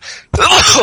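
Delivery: sudden loud high sneeze; sneezing. Real, not acted. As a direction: burst out with a real sneeze. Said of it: "perdóname que voy a estornudar".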